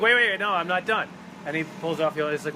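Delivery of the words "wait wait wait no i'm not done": eager excitement. Retold or imitated. Marcus eagerly stops Barnes leaving.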